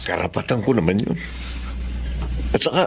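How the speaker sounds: low, quiet reflective thoughts; inner monologue. Acted. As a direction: wonder aloud to yourself, hurt.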